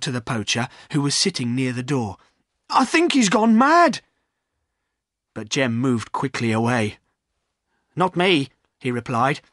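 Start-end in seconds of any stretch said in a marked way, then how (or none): 2.7-4.0 loud, high-pitched alarm. A narrator's reading. Landlord warning the poacher about Silas, alarmed. 7.9-8.5 higher-pitched, uneasy refusal; nervous refusal. A narrator's reading. Poacher refusing to grab Silas, nervous. Jem backs away from ghost.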